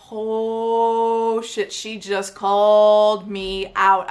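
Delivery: long drawn-out "oh", slowly dawning; retold shock, stretching the words right out; slow, hammed-up floored reaction; stunned realization. Retells stunned reaction to coach.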